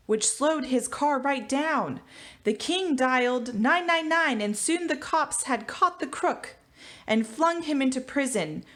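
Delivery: ordinary talk, nothing marked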